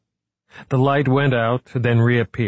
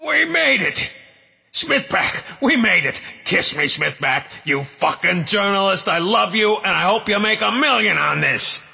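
high, giddy, half-sobbing joy; ecstatic shouting; 11 semitones higher than usual. Acted. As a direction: shout it through laughter and tears. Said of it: "laughing, crying".

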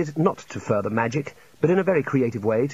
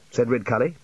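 usual speaking voice

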